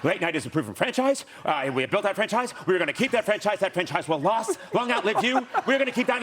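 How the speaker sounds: doing the buttoned-down executive, building to a lecture; Rick Ludwin impression. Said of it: "that's an excellent Rick Ludwin".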